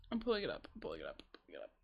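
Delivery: muttering through the burn; strained mutter with mouth on fire; mouth burning from spicy food. Real, not acted. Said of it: "Oh god, I can't function".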